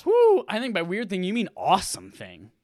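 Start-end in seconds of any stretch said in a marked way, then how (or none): 0.1-0.4 high excited cheer; excited whoop. Real, not acted. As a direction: whoop with delight. Cheers for Game Awards.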